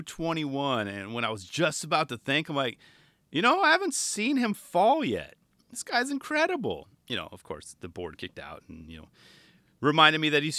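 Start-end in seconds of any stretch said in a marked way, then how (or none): 3.3-6.9 high-pitched, marvelling inner voice; retold wonder in a raised pitch; awed thought. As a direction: act out naive amazement way up high. Acts out his own awed thought.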